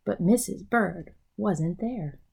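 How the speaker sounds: plain reading voice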